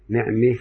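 نعمة with the fatha leaning toward kasra, "ne'me"; نعمة with small imala; imala on ni'ma. Shown as a demonstration. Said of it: "ننطقها بين الفتحة والكسرة".

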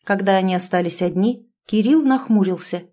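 ordinary delivery

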